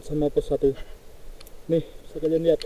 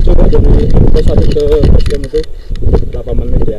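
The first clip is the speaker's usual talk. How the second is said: loud voice raised over strong wind; shouting over wind; 2 semitones higher, 12 dB louder, faster than usual. Real, not acted. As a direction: call out loudly against the gusts. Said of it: "karena berhubung anginnya besar banget".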